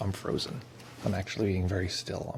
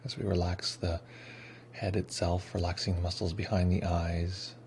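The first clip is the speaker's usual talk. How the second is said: guided meditation; 7 dB quieter than usual. Acted. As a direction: ease them into stillness, unhurried. Leads the meditation.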